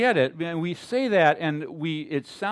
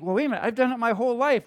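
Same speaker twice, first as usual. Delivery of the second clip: objecting believer; 7 semitones higher, 5 dB louder, much faster than usual. Retold or imitated. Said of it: "And then you think".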